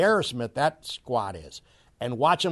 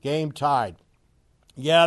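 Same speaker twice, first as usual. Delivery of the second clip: normal talking voice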